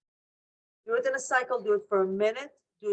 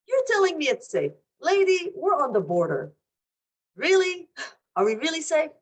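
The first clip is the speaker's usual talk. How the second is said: higher, pointed challenge, rising on the questions; incredulous protest; 5 semitones higher, 4 dB louder than usual. Acted. Person at the border answering a helper, incredulous. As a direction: push back in disbelief at the reassurance. Voicing a disbelieving person under fire.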